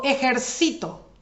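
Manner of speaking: ejercito with CI stressed; ejercito, voice loaded onto CI; stress on penultimate syllable. Shown as a demonstration. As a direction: lean on the next-to-last syllable: e-jer-CI-to. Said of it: "la sílaba tónica o que soporta el acento es sí".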